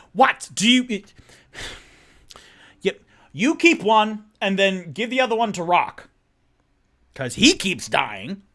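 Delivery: high, loud call across the room. Acted. Toot Toot calling from the other side of the room, loud. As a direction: shout over to them.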